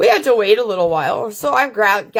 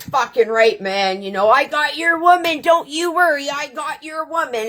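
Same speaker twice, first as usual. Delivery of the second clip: cocky reassurance; 6 semitones higher than usual. Retold or imitated. To her male friend, vouching for his partner: a cocky buddy boast. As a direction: puff up and promise him you've got it covered. Telling friend she's protected.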